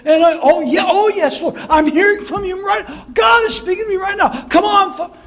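mock TV preacher. Mocking a TV preacher shouting that God speaks to him, hammed-up. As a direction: ham up hearing God live. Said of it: "with that kind of a spirit".

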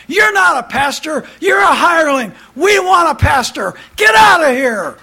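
loud, high shouted demands from the pews; voiced congregation shouting. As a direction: yell like an angry flock firing its pastor. Congregation voiced driving out pastor.

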